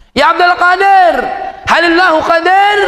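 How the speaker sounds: bertanya tinggi dan lantang; suara si pemabuk. Retold or imitated. Pertanyaan ketiga pemabuk. (pemabuk bertanya keras)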